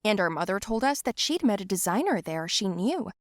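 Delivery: ordinary delivery, nothing marked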